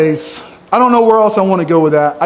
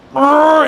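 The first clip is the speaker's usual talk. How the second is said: flex grunt; 8 semitones higher than usual. Pretend. Flexing on stage, makes a noise.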